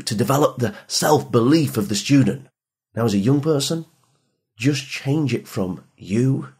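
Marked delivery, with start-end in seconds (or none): none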